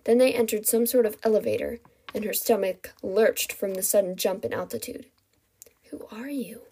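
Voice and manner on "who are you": hushed question; whisper. A narrator's reading. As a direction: whisper it, barely audible. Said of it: "she whispered".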